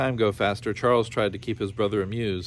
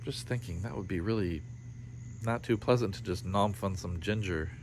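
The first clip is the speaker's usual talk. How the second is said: quiet aside; 3 semitones lower, 8 dB quieter than usual. Real, not acted. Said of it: "narrator's quiet aside".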